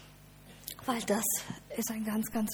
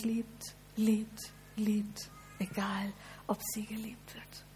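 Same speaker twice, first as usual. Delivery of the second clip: fading to a whisper; 6 dB quieter, slower than usual. Acted.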